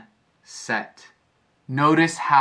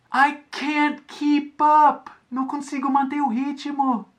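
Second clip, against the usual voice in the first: yelling out; 14 semitones higher than usual. Retold or imitated. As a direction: call out that you can't keep up. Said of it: "I yelled to A J".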